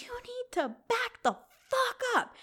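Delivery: high-pitched yell without the volume; retold yell. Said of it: "heard me yell like that".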